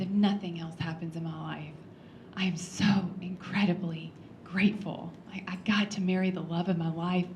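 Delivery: lightly voiced husband, almost breathless hush; hushed, tender quote. Widow retelling husband's last words in a soft near-whisper.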